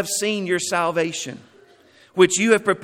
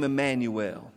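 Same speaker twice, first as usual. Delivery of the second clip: ordinary delivery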